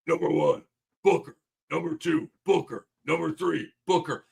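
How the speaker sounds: counting off in a mock Thibodeau voice; a hint of a gruff coach calling out his scouting report; Tibbs voice. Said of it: "the Tibbs voice right here".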